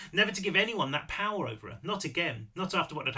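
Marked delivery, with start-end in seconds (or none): none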